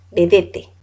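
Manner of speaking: Vedette said be-DE-te with a final e sounded. Shown as a demonstration. Teacher telling students the right way to say Vedette. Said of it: "así se debe decir".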